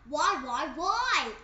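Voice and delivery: frustrated whining. Acted. Pigeon complaining about asking, a loud building whine. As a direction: wail the whys like a sulky bird.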